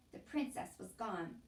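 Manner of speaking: plain reading voice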